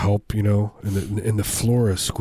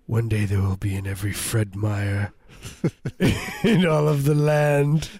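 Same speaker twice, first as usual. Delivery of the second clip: grand visionary inventor. Retold or imitated. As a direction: announce the dream like a pompous inventor, laughing. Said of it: "He's like".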